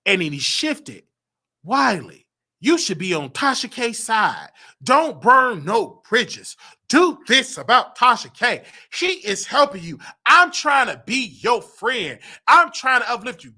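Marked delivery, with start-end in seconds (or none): 1.6-13.5 louder, slow pressing advice; voicing Conscious. Conscious urging Wiley to side with Tasha, louder slow insistence. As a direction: push him firmly, word by word.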